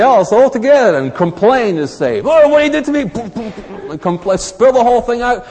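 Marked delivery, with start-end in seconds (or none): 2.2-3.0 mock complaining whine.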